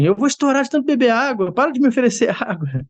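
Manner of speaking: very high, laughing complaint; a fed-up wife squealing in protest; exasperated wife. Retold or imitated. Wife fed up with being offered water, very high and laughing.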